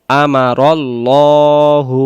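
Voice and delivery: very slow chant with a heavy, full l in Allah; Quran recitation. Shown as a demonstration. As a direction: hold each syllable long. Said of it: "এটা মোটা হবে". (মোটা করে টেনে তিলাওয়াত)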